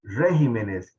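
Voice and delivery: imitating a slip, "Regímenes" stressed on RÉ; misplaced stress. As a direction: hit the first syllable of "Regímenes" hard. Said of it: "Una vez en cadena nacional de televisión dijo".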